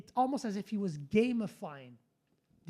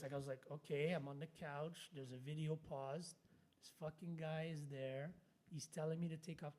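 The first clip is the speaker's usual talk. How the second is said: hushed inner thought; 5 semitones lower, 14 dB quieter than usual. Retold or imitated. Inner panic on the couch.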